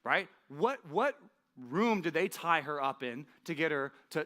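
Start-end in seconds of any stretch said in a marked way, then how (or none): none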